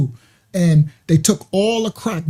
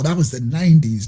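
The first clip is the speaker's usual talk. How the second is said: normal talking voice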